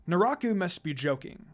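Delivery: plain reading voice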